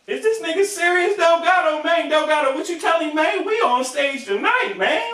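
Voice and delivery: high whiny mock of Crunchy; Crunchy's incredulous voice. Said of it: "He was like".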